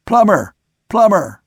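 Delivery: loud, high call up the mast; shout. A narrator's reading. Sailor calling up to a mate in the dark, shouting. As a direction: call his name up into the dark. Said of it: "I shouted".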